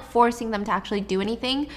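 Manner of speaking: ordinary delivery, nothing marked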